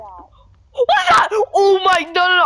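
high scared shout; screaming in panic; panicked scream. Real, not acted.

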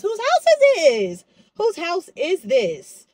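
slow, high, drawn-out whose house is this; slow amazed question at the empty room; mock disbelief. Real, not acted.

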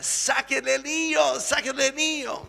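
high, loud urgent yell. Acted. Juan yelling at María to take off the ring, high urgent shout. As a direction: shout after her, panicked about the ring. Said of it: "Y Juan le grita".

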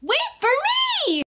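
high, pleading wait for me; high eager call. Acted. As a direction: call out, afraid to be left behind. Character calls after.

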